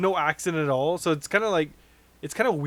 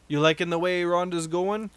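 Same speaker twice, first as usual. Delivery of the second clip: ordinary delivery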